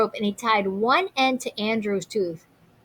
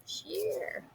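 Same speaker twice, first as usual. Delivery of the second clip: soft sceptical whispered aside; 9 semitones higher, 17 dB quieter than usual. Real, not acted. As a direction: breathe it as a doubtful aside. Teacher's aside to class.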